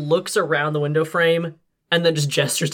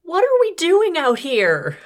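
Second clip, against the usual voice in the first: scared character voice; 13 semitones higher, 5 dB louder, slower than usual. Acted. Clara in character, frightened.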